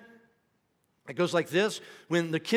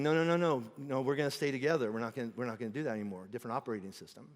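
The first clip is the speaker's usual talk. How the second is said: firm gentle Jesus; 4 semitones lower, 5 dB quieter, faster than usual. Retold or imitated. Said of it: "He's like".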